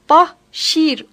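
"Bahşir" split slowly as bah, sheer; "Bahşir" with drawn-out bah and long shiir; English pronunciation. Shown as a demonstration. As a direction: sound out "Bahşir" in two separate syllables. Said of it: "olarak telaffuz edilir".

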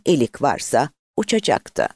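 ordinary delivery, nothing marked

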